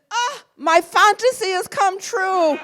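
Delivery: high, loud, slow Italian director; delighted Italian director; Fellini voice. Retold or imitated. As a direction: boom it out like a thrilled maestro.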